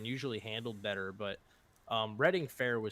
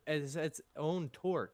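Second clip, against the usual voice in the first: slow, halting restart of a phrase; tongue-tied and dragging; stumbling over words; 3 semitones higher, slower than usual. Real, not acted. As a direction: trip on the words, then push through slowly. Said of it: "Good Lord, I'm stuttering".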